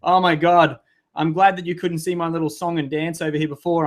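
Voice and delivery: normal talking voice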